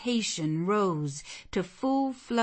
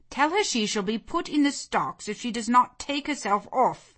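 raised, louder magistrate's threat; stern order; 7 semitones higher, 5 dB louder than usual. Acted. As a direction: order her off with authority. Magistrate threatens an unwanted visitor.

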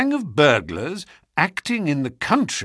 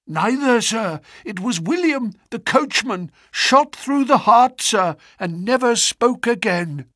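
high, urgent servant's voice, gasping out the news; a shaken butler bursting in; breathless butler; 11 semitones higher than usual. A narrator's reading. Butler reports the shooting.

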